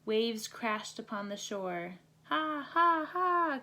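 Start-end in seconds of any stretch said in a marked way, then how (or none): 2.2-3.6 high squawking ha ha ha; gull laughter. A narrator's reading. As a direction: cackle like a laughing seagull, high. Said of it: "cried the laughing gulls".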